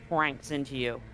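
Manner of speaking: ordinary delivery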